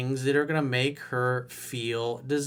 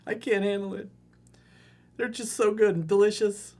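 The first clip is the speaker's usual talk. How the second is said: put-on high childish whimper, much higher; mock whiny child; 7 semitones higher than usual. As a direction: play a frightened little boy begging mommy. Mocking whiny guy continues.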